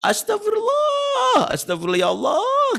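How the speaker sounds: drawn-out, high exasperated cries to God; mock angry parent yelling. Parent yelling at a misbehaving child, slow and shrill. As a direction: wail the names like a fed-up parent. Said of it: "Kadang-kadang teriak lagi".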